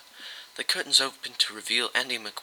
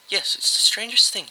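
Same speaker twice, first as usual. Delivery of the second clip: lightly voiced secretary, a bit higher; a hint of a higher secretary voice; 11 semitones higher, 5 dB louder than usual. A narrator's reading. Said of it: "she said".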